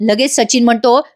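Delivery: ordinary delivery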